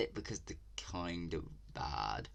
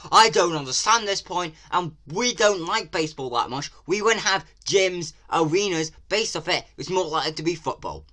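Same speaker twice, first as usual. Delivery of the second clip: louder and higher, exasperated; heated rant; 8 semitones higher, 7 dB louder than usual. Real, not acted. Vlogger scolding the game makers about England. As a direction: scold Japan for getting it wrong. Ranting at the trailer.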